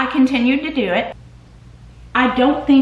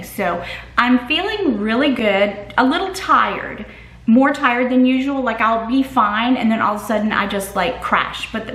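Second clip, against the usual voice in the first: usual speaking voice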